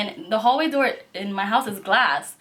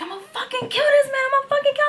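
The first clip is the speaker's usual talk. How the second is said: very high furious rant; very high, hammed-up rage; mock furious roommate; faster than usual. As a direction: play the enraged roommate.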